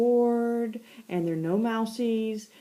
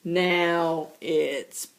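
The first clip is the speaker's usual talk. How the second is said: lower, very slow threat; cat vowing revenge, lower and slow; menacing vow; 5 semitones lower, much slower than usual. Acted. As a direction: spell out the threat slowly. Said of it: "Chester says".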